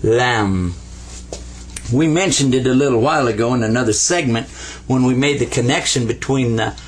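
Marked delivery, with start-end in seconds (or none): 0.0-0.7 "Lam" low and deliberate; lam demo. Shown as a demonstration. Teacher models lam for students. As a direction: state "Lam" firmly for repeating. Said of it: "this is called lam".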